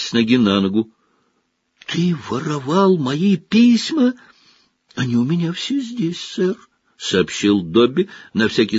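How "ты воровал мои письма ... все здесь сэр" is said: high little elf voice; house-elf. A narrator's reading. Dobby answers Harry.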